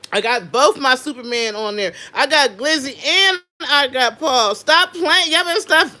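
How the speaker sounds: loud, high, hyped-up bragging; hyping her favourite shirt to viewers, loud and excited; hype. Real, not acted. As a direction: shout it out like a proud fan.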